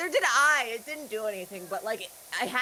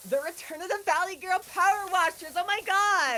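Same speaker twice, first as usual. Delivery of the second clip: put-on Valley Girl voice; 10 semitones higher, 4 dB louder than usual. Joining the Valley Girl bit.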